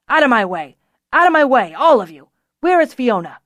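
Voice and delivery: loud barked orders; angry shouting. A narrator's reading. Tamar shoves through looking for Fiona, angry shouting. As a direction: shout to clear a path. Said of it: "his anger obvious".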